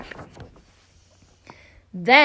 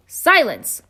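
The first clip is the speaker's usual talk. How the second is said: sharp loud bark of a king; barked command; 5 semitones higher, 7 dB louder than usual. Acted. King barks at the turtle Mac below. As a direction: snap it out to silence him. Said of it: "barked back".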